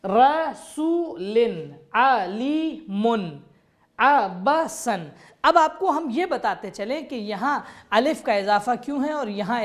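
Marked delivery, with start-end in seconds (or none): 0.0-5.1 slow syllable-by-syllable रसूलिन, आलिमुन, अबसन; letter by letter. Shown as a demonstration. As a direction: break each word into its letters. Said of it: "तोड़ तोड़ के हर हर्फ को अलग अलग पढ़ेंगे". (हर हर्फ अलग अलग धीरे पढ़ें)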